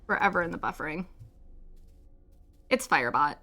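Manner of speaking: normal talking voice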